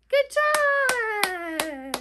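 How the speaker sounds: high, loud "well done" cheer; cheering praise. Real, not acted. Praising children after the song.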